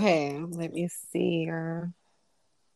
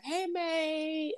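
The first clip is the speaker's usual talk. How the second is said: a quick higher Australian "Hey, mate"; lightly put-on Aussie greeting; Australian accent; 11 semitones higher, 6 dB quieter than usual. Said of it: "I was gonna do that first".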